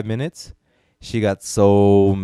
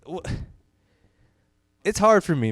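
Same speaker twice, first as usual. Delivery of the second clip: ordinary delivery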